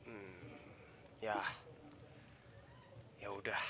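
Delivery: whispering. Real, not acted. Player gives up, sighing.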